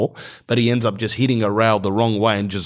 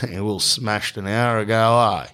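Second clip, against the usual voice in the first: lackluster nonchalance. Retold or imitated. Stoner bakery worker brushes off a customer, lackluster and nonchalant. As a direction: answer nonchalantly, dragging the words out. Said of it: "lackluster, kind of nonchalantly looks at me".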